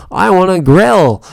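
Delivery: a loud, high, slow shout; exasperated shout. Retold or imitated. Pleading with the clerk to let him grill, slow loud shout. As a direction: bellow the plea, stretched out. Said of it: "And I'm just like".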